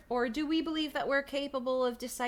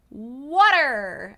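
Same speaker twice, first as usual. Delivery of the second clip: loud mock wail, swooping up and down; exasperated cry; 3 semitones higher, 11 dB louder than usual. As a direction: wail the word in hammed-up desperation. Comic repeated cry for water.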